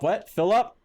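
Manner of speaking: high, loud Philip?; surprised double-take on Philip; surprised question. Real, not acted. Streamer surprised to spot Philip in the paper.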